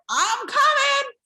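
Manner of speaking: high, loud calling out; mock eager call. Answering the rowers, calling out loud and high. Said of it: "You're like".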